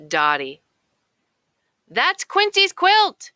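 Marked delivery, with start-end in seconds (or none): 1.9-3.3 high, loud alarmed duck call; loud quacking cry. A narrator's reading. Duck warns everyone the dog stole the quilt, high loud cry. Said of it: "quacks Dottie".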